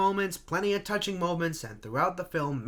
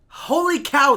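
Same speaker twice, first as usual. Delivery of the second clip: excited; 9 semitones higher, 9 dB louder, slower than usual. Real, not acted. Reacting to the film plot.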